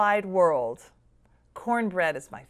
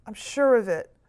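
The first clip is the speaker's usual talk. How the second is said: usual speaking voice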